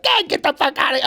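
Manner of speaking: high, fast mock outburst; irate boomer dad. Boomer father dismissing a stranger in a restaurant, mock irate. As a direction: imitate a dad blowing up. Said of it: "So imagine my father, who's a boomer".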